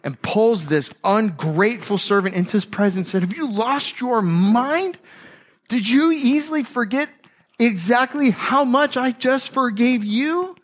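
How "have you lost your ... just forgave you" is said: slow, high-pitched indignant questioning; furious king. Acted. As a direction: confront the ungrateful servant, stretching words. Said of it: "the king is furious".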